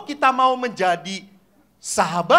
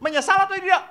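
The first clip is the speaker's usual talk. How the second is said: high, raised challenge; insistent priest; 11 semitones higher, 4 dB louder, much faster than usual. Acted. Priest presses children on their regret, high insistent question. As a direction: demand their answer.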